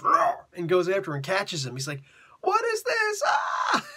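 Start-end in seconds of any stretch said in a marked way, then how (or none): 0.0-0.5 high loud mock monster roar. Living suit roaring as it lunges at the kid. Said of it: "the suit just goes". 2.4-3.8 much higher, shouted cry of fright; scared scream. Retold or imitated. Kid screaming as the suit catches him.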